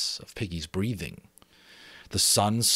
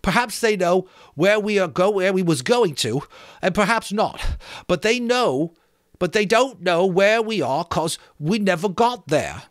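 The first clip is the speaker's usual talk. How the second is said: breathless Piggy; 11 semitones higher, 7 dB louder than usual. Acted. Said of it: "paler than before and breathless".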